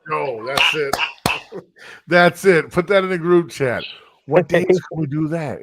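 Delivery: excited. Real, not acted. Loves the joke name.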